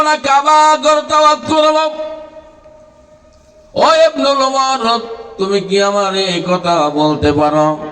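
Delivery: half-sung preaching, opening high and ringing; melodic sermon storytelling. Acted. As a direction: chant the son's impassioned answer.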